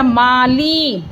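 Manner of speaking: "माली" slowly, in two long syllables; teacher's drawn-out "माली"; stretched vowels. Shown as a demonstration. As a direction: draw out "मा", then a long ee on "ली". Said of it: "ला को खींच के बोला मैंने".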